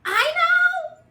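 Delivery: high loud I know; excited. Acted. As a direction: shout the bright idea. Excited eureka in story.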